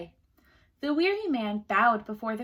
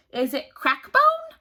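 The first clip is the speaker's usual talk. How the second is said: queen guessing; 14 semitones higher, 9 dB louder than usual. A narrator's reading.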